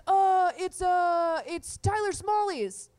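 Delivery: high, loud hesitant confession; nervous brother. Retold or imitated. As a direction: stall then blurt a name, caught out. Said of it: "And he goes".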